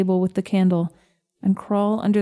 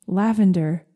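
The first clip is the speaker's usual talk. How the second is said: usual speaking voice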